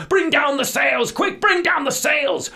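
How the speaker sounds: high, loud urgent yell; captain shouting at his crew in a storm; captain's shout. Acted. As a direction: bellow the order over the storm. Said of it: "the captain cried".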